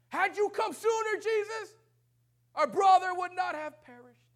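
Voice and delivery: high, loud, slow anguished cry of Martha; Martha's grieving reproach. Acted. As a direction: wail it at Jesus like a grieving sister. Martha reproaches Jesus, acted.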